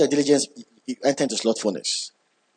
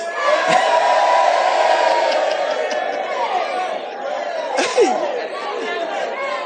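long high laugh. Real, not acted. Laughing at own binge joke.

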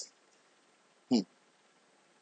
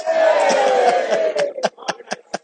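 high shouted laugh; 10 semitones higher, 3 dB louder than usual. Real, not acted. Laughing at the series joke.

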